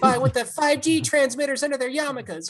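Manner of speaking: mock angry screed. [name] voicing a crank's manifesto from the farm, mock angry. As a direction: play a crank ranting at full tilt. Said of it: "good place for angry screed".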